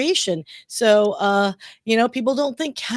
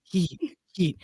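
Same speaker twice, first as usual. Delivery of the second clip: echoing trailer voiceover; 4 semitones lower, 5 dB quieter than usual. Acted. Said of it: "I did that voiceover".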